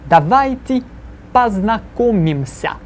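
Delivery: slow, deliberate model for students to repeat; each syllable spelled out slowly so the class can echo it; slow repeat-after-me. Shown as a demonstration. Teacher models the phrase slowly for students to repeat. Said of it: "when I say something this painfully slowly".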